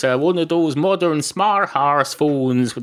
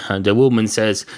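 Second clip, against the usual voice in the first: ordinary delivery, nothing marked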